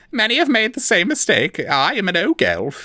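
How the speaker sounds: small frightened creature pleading; Forficule voice. A narrator's reading. The captive elf lies to his torturer, high and timid.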